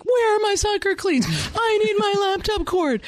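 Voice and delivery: put-on high-pitched demanding child; mock kid yell. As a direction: imitate kids hollering for their stuff. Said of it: "keep yelling at me".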